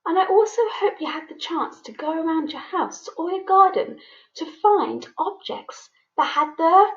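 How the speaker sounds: normal talking voice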